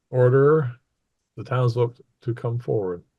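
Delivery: ordinary delivery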